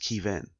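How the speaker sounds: [name] said "KEE-vin"; unusual name pronunciation. Acted. Politely correcting how his name is said, long-EE pronunciation. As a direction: stretch the first vowel of [name] to EE. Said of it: "it's actually pronounced".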